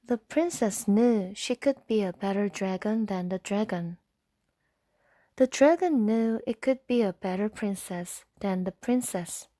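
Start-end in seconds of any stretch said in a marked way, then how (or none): none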